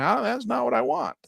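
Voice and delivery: high-pitched mock complaint; exasperated. Trader reacting to Gemini's wrong list, mock exasperated. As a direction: whine in mild frustration.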